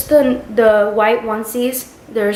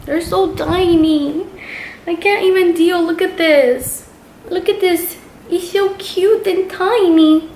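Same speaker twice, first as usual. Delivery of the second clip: higher, squealing gush over tiny clothes; gushing over cute; 8 semitones higher than usual. Real, not acted. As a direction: coo at it like it's the cutest thing ever.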